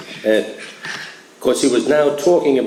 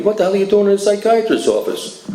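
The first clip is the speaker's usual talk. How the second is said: higher, blunt doctor's voice with a changed timbre; doctor bluntly grilling a young patient, imitated higher; 9 semitones higher than usual. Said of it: "This is the way he talked to me".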